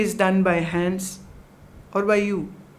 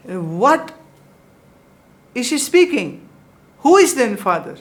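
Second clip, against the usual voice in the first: angry retold shout; 4 semitones higher, 6 dB louder, faster than usual. Father slaps, yells at wife.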